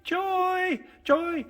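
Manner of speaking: high, loud, drawn-out calls; big sustained cry; calling out. Acted. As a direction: holler it far and wide, holding the vowel. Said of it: "crawling out for joy".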